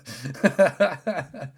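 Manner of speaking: loud, high burst of laughter; a real run of high chuckles; laughing. Real, not acted. Man amused by his own permanent paranoia, laughing.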